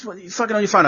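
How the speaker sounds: mock wife, pitched high; husband imitating his wife's scolding, pitched high; wife's telling-off. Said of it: "She goes, she goes".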